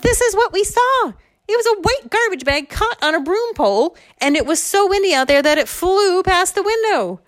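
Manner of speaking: high-pitched, loud explanation; acted breathless-with-news excitement; excited reveal. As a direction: announce the solved mystery in a squeal. She triumphantly reveals the garbage bag.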